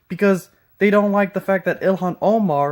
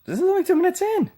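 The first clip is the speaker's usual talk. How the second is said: exasperated; 13 semitones higher, faster than usual. Real, not acted. Exasperated complaint.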